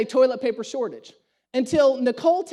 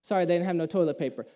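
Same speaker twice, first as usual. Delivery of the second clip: parents' low apology; 7 semitones lower, 4 dB quieter, faster than usual. Retold or imitated. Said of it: "They come back from Costco".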